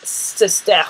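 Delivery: Leicester said as "Lester", softly; English pronunciation. Shown as a demonstration. Showing how Londoners say Leicester as "Lester". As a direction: Leicester clipped to two syllables, "Lester". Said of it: "comme s'ils oubliaient le".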